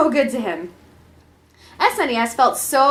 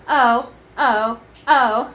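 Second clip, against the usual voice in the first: mock moaning; much slower than usual. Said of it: "moaned S N E S".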